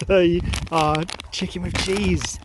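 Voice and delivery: ordinary delivery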